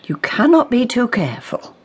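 ordinary talk

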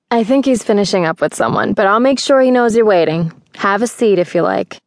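a hint of a helpful woman at the counter; lightly voiced receptionist. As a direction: lightly play an obliging front-desk woman.

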